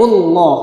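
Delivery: ALLAH with a heavy, dark L, rounded toward "lloh"; heavy lam. Shown as a demonstration. Teacher giving a heavy-reading example, heavy lam in ALLAH. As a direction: say "Allah" slowly with a thick, held L. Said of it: "Contoh yang dibaca berat".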